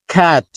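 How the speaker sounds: খ্যাট with a breathy start and no puff at the end; aspirated k. Shown as a demonstration. Teacher shows aspirated start, plain end. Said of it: "প্রথমটাকে aspirated করছি খ".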